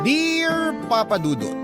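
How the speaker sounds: ordinary talk, nothing marked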